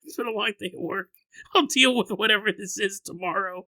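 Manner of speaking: fast, laughing, tired dismissal; laughing shrug-off. Pretend. Finding a ghost in the hallway after a long day, laughing shrug. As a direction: brush it off through laughter, too tired to care. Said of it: "And you go".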